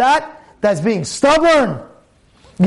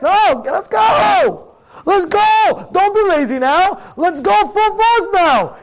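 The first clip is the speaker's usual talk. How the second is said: high, drawn-out urging shout; full-force pep-talk yell; rallying shout; 8 semitones higher, slower than usual. Acted. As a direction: rouse a lazy student out of bed. Acted rallying of lazy student.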